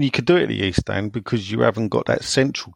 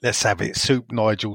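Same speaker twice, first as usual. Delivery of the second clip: usual speaking voice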